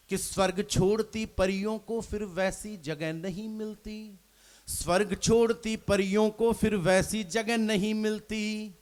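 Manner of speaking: measured recited couplet, each line dwelt on; poem recitation. Acted. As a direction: recite it like a stage poet. Poet reciting verse on stage.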